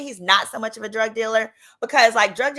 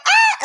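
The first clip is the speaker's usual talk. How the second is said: high shrieked ah, loud; holler; 9 dB louder than usual. Retold or imitated. Reading the article on her phone, loud shocked holler. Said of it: "I holler".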